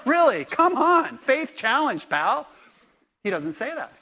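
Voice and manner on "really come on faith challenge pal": louder, higher, mock exasperated scolding; Jesus needling Thomas, hammed-up exasperation. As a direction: tease him as a faith wimp. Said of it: "he's gonna go".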